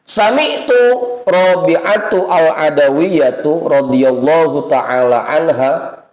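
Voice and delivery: slow, measured Arabic reading; drawn-out reading of classical Arabic; kitab recitation. Acted. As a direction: intone the text like a kitab lesson. Reads Arabic kitab text aloud.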